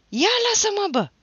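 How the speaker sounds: very high loud shake-off; angry shout breaking away. A narrator's reading. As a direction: yell it while pulling free. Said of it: "strigă el".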